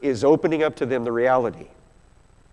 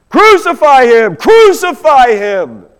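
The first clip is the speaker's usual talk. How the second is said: loud, high yell, each word drawn out; full-force shouted chant; crowd yelling; 10 semitones higher, 11 dB louder than usual. Acted. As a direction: yell it like a crowd demanding an execution. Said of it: "they were yelling".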